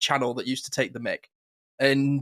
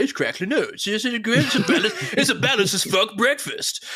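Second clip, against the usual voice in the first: puppet cereal ad; 7 semitones higher than usual. Retold or imitated. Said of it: "the puppet's like".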